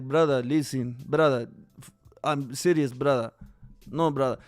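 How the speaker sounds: lightly voiced Khabib laying it down calmly; Khabib impression. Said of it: "Khabib talk like".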